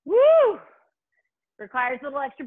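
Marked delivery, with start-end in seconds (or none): 0.1-0.6 excited cheer. Real, not acted. Workout cheer.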